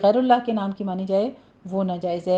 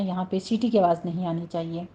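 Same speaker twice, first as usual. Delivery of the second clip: normal talking voice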